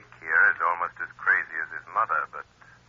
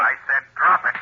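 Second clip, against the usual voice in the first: stern command; 9 semitones higher, 4 dB louder than usual. Acted. Shadow commanding Jake.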